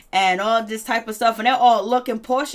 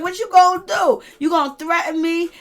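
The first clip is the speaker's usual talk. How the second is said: defiant taunt; 6 semitones higher, 5 dB louder than usual. Retold or imitated. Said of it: "And Drew was like".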